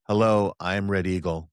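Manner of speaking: usual speaking voice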